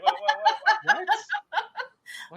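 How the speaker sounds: very high stunned what, what; mock disbelief. You react to the driver's confession: very high disbelief. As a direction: squeal out your disbelief.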